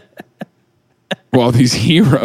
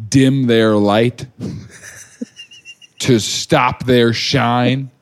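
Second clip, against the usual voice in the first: mock solemn, slow; much slower than usual.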